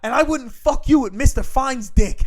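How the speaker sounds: high, loud, slow mock rant from the father; mock angry yell. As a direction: spit out the last insult at full volume. Dad's final insult, retold.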